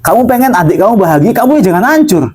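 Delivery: first insistent high-pitched telling, "jangan hancur" driven up; emphatic correction. Shown as a demonstration. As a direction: correct them firmly, voice climbing high.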